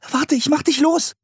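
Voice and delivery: put-on high, urgent child's shout; calling out. A narrator's reading. Girl promising to free her brother, high urgent call. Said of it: "rief Anne".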